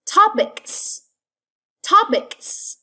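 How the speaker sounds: Topics, high TOP and a long s ending; hissed final S. Shown as a demonstration. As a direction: stretch the end of Topics into sss. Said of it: "the S must say S".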